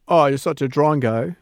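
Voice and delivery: a light, hammed-up Australian accent; bad Australian accent. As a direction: toss it off in a jokey Aussie accent. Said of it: "That was really bad Australian accent".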